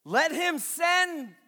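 high, loud, slow declaration; preacher booming the line to the congregation; loud proclamation. Acted. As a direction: proclaim it boldly to the room.

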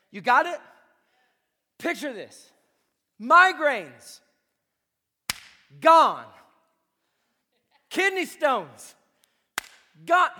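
loud shouted proclamation; preaching at full force. Acted. Pastor shouting healing over the congregation, triumphant. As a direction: declare each Gone like a victory.

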